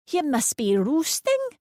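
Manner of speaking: playful concern; old lady's voice. A narrator's reading. Linda teasing Lucy about her clothes, playful.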